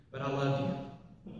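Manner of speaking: slow, low and very soft; tender reassurance. Acted. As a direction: soften right down into acted affection. Husband softens after admitting anger.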